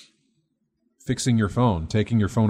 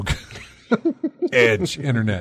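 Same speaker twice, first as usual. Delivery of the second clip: laughing; much slower than usual. Real, not acted. Nostalgic joke about old phone.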